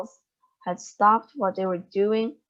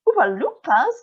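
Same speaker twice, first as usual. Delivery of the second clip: surprised; 5 semitones higher, 7 dB louder than usual. A narrator's reading. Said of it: "everyone said at once".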